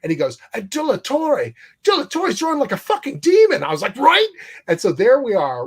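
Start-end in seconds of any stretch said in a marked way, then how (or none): 0.5-3.6 high, excited, louder rave; Richard raving. Retold or imitated. Richard hyping the artist in the aisle, high and excited. 4.0-4.3 high, loud excited "Right?"; excited agreement. Retold or imitated. Jim agreeing with Richard in the aisle, high and thrilled.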